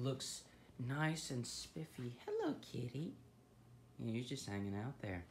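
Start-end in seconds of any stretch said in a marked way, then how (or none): 2.2-3.1 pet voice. Real, not acted. Greeting the cat.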